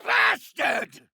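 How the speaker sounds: very high, drawn-out furious shout; enraged curse. Acted. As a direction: scream the curse at your enemy. Villain curses his enemy.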